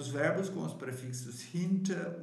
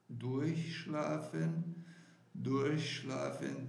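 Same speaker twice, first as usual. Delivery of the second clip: "durchschlafen" said slowly, syllable by syllable; slow demo; slower than usual. Shown as a demonstration. Teacher models "durchschlafen" slowly for students. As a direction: stretch out "durchschlafen" for learners. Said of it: "teacher repeating word slowly".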